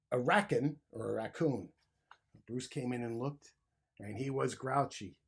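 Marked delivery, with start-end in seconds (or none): none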